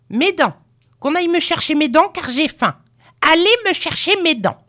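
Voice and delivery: put-on high, fast, imperious old-woman voice; clamouring demand. A narrator's reading. Hungry grandmother sends for her false teeth, clamouring. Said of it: "elle réclama".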